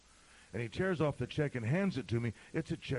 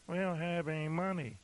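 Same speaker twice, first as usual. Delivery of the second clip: mock complaint; 6 semitones higher than usual. Mimics an objecting church member.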